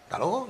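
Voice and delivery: quiet "hasta luego" run into "talogo"; offhand "hasta luego", first syllable dropped; reduced form. Shown as a demonstration. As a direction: say "hasta luego" softly as "ta logo". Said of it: "que es lo que se dice".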